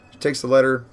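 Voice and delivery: usual speaking voice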